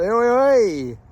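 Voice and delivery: loud high call across the bank. Acted. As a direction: shout a cheery greeting.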